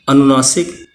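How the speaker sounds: ordinary talk, nothing marked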